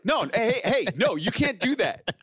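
high-pitched, urgent mock objection; protesting barista. Starbucks guy stopping a customer eating the cakes, alarmed protest. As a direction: imitate a worker calling out to stop a customer.

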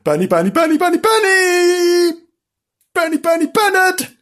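high, loud calls for the cat; calling loudly. Acted. Two children calling the hidden kitten, loud and high. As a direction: shout the name to bring the cat out. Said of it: "called him loudly".

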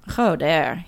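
"Joder" sworn with a throaty J. A narrator's reading. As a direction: start "Joder" on a rasped throat H sound. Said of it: "he swears with a throaty J".

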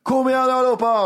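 a hammed-up DJ shouting to the crowd; mock DJ hype. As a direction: call out like a cheesy DJ. Mocking DJ hyping the crowd.

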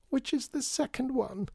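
put-on very high little child's whine; wailing. A narrator's reading. Child lost in the storeroom answering father, wailing. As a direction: wail it helplessly from far back. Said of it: "wailed a voice from the shadows".

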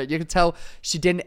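ordinary talk

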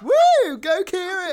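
high, loud whoop; cheering. Real, not acted. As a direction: cheer like your team scored. Cheering for a friend.

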